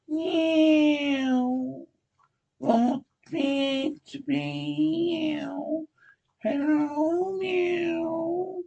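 high, eerie drawn-out mewing; cat voice. Acted. Cat-woman mewing at the officer, high and eerie. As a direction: purr the words like a cat woman.

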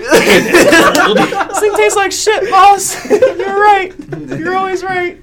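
high, slow, laughing sidekick voice; a dopey lackey agreeing with the boss; goofy henchman voice. Acted. Repeats Freddy's line in character.

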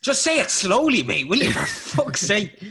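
loud, high-pitched mock exasperation; exasperated plea. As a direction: plead in acted frustration, voice jumping up high. Acted plea to a garbled speaker.

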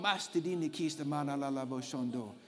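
soft rhythmic tongues, murmured; speaking in tongues. Real, not acted. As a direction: let the syllables flow softly. Praying in tongues.

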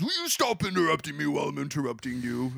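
lightly voiced statesman scolding an interrupter; put-on orator voice, mildly indignant; a hint of a grand old leader; Churchill. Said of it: "obviously I'm doing the voice for Churchill".

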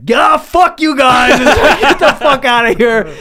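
put-on young guy shouting at shady drivers to get lost; retold freak-out shout. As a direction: blow up at the strangers in the car. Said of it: "I flipped out and just was like".

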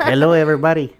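loud, high mock-Arabic accent; imitated Arabic accent. Host mimics Mommy greeting listeners, loud high mock Arabic accent. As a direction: call it out in a hammed-up Arabic accent. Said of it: "this is my accent".

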